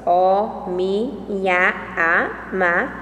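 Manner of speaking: "O, mi, nha, a, ma" spoken one syllable at a time, each set apart by a short pause; slow syllable-by-syllable count of "O, mi, nha, a, ma"; split into poetic syllables. Shown as a demonstration. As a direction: say "O mi nha a ma" as separate beats, syllable by syllable. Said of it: "conforme as sílabas poéticas".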